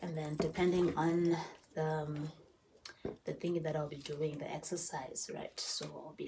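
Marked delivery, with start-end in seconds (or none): none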